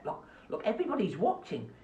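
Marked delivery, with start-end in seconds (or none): 0.0-0.6 soft unvoiced look, look; whispered aside. Host whispering to the rat puppet to behave. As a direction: whisper it so the viewers can't hear.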